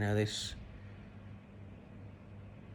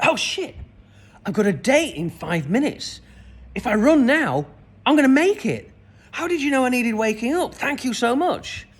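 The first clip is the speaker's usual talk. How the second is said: high, loud, panicked rush of thanks; sudden panic as he realises he's late; excited panic; 11 semitones higher, 7 dB louder than usual. Retold or imitated. Woken stranger realises he's late for his date, loud and high panic.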